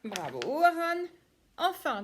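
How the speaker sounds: ordinary talk, nothing marked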